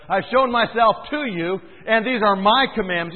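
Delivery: fiery preacher building his case; preaching at full force. Acted. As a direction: pound the point home to the congregation. Preaching resumes after self-correction.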